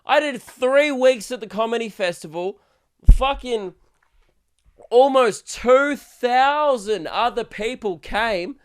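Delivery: loud, high ranting shout; mock-indignant rant. Comedian yelling at a fan who says he never visits, loud rant. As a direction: fire it back at the fan, outraged.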